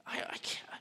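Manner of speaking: a quiet whispered aside. Real, not acted. Preacher whispers to himself about the insult, whispering. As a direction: drop to a breath of a whisper.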